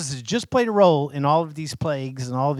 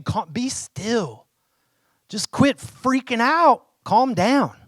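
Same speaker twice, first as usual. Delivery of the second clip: slow, higher, pleading "be still" that rises into urging; Moses calming the crowd; 7 semitones higher, slower than usual. Retold or imitated. Preacher voicing Moses urging the frightened people to calm down, slow and pleading. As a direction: stretch it out like a leader begging panicked people to settle. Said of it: "he's basically saying".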